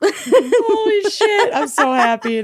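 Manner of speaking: high, slow, delighted exclamation; excited. Real, not acted. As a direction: burst out with joy. Overjoyed at recording at night.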